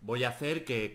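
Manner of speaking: ordinary delivery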